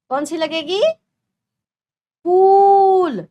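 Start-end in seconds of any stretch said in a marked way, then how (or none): 2.3-3.3 फूल said with a long, high, loud ऊ; drawn-out long vowel. Shown as a demonstration. Teacher exaggerating फूल for pupils. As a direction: stretch the ऊ in फूल. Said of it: "फूल नहीं बोलते हैं ना".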